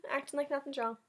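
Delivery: ordinary delivery, nothing marked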